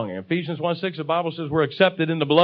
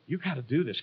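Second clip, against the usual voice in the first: softer, lower aside; gentle urging; 5 semitones lower, 11 dB quieter than usual. Acted. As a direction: coax rather than command. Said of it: "doesn't say that in a mean, forceful way".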